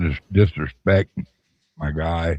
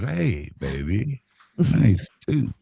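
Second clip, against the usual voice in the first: mock pickup line; 5 semitones higher, much slower than usual. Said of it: "first thing you say to her is".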